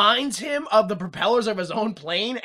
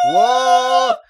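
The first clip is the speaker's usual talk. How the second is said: a much higher, loud, stretched "what?"; shocked shout; 9 dB louder than usual. Retold or imitated. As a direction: react to a stunning revelation. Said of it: "And he's like".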